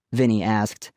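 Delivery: plain reading voice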